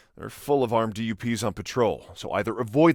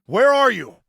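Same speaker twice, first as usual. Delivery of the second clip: raised, high worried demand; urgent calling out; 10 semitones higher, 6 dB louder than usual. Acted. Officer trying to locate his brother, urgent calling out. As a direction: call out loudly to find him.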